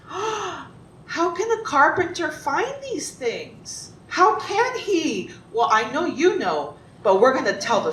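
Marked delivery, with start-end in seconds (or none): 0.0-5.3 gasp, then high slow disbelief; gasping dismay. A narrator's reading. Storyteller to children about impossible tasks, gasping slow high dismay. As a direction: marvel aloud, drawn out and high.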